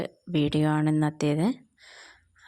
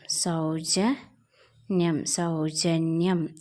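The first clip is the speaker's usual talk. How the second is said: സൗജന്യം stretched slowly, syllables held; drawn-out teacher reading of സൗജന്യം; slow careful reading; much slower than usual. Shown as a demonstration. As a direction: read സൗജന്യം slowly for a learner. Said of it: "നമ്മൾ പറയണ".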